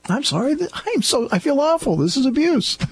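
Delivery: hammed-up Monty Python whine; high mock-posh. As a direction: replay the sketch voice, hurt and prim. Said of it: "Only Monty Python could do that".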